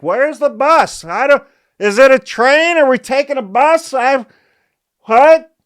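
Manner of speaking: high, loud panicked ditz voice; confused friends. Retold or imitated. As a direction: play the lost friend, squealing with confusion. Friends confused about transit.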